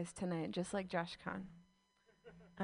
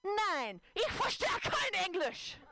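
curt German reply; 9 semitones higher, slower than usual. Acted. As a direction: snap back a flat refusal in German. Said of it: "here's the conversation".

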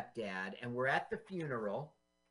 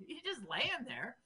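laughing; 8 semitones higher, 4 dB quieter than usual. Retold or imitated. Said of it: "And I go".

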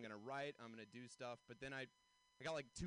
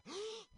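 sharp, high shocked gasp; loud intake of breath, mock horror; big gasp; 3 dB louder than usual. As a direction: imitate a scandalized gasp. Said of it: "this lady gasped, like a".

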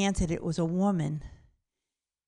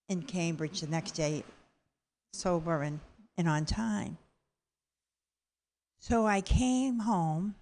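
ordinary talk, nothing marked

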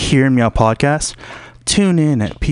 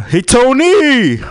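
much higher, loud drawn-out call; vendor call; 13 semitones higher, 5 dB louder, slower than usual. Retold or imitated. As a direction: hawk it like the vendor. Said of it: "So my dad".

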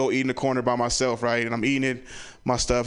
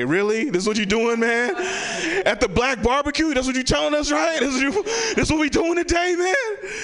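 rising, higher and higher rant; outraged yelling uncle; 13 semitones higher than usual. Retold or imitated. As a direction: build from annoyed to shrieking outrage. Family member yelling at barbecue.

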